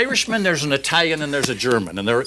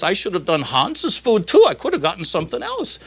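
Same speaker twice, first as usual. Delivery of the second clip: mock grief, pitched up; German wife; 8 semitones higher than usual. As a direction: lament as a joke German wife. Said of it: "the German lady".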